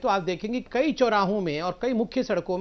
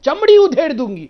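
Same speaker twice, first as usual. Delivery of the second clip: mock threat; 3 semitones higher, 11 dB louder than usual. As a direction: ham up a furious stage threat. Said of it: "कहा था ना". (नकल करते हुए ज़ोरदार धमकी)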